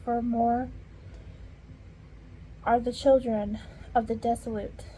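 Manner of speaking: ordinary talk, nothing marked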